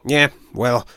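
a fed-up forager venting; irritable squirrel. Acted. Ashford complaining to Blue Tongue about the badger, fed-up voice.

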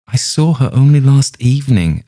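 high, loud, emphatic protest; agitated insistence. A narrator's reading. Young man contradicting the rabbi, agitated insistence.

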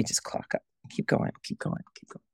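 breathy whispered chant; whispering. Pretend. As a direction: whisper it to yourself, like a mantra. Mock weary chant over emails.

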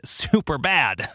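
usual speaking voice

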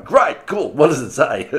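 high, loud burst of mock delight; loud, high-pitched eager cheering-on; eager excitement. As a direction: act out an excited, impatient crowd. Acting out the eager independent media.

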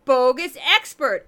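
put-on loud, drawn-out goofy Minnesota accent; slow, booming mock Minnesota accent. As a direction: announce it big in a hammed-up Minnesota accent. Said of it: "that's where I do my goofy Minnesota accent".

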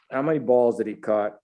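normal talking voice

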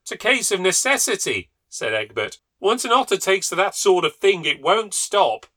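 high, loud insistence, slowing at the end; stern husband. A narrator's reading. Husband insisting to his wife, loud and firm. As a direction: lay down the law.

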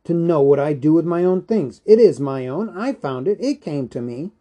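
heated claim of ownership; indignant cry. A narrator's reading. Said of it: "he cried".

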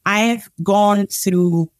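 ordinary talk, nothing marked

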